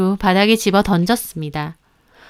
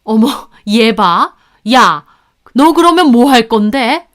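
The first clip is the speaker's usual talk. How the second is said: loud, scolding in-law voice; enraged mother-in-law; 5 dB louder than usual. A narrator's reading. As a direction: round on the daughter-in-law angrily. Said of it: "어머님 갑자기 분노하셨고". (버럭 화내는 시어머니)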